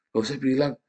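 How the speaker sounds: normal talking voice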